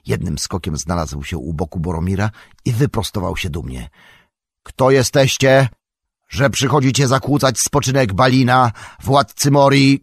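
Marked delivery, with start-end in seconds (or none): 4.6-10.0 high, loud challenge; resounding shout. A narrator's reading. Gandalf challenging those disturbing Balin's rest, resounding shout. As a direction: shout down the intruders with authority. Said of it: "krzyknął donośnie".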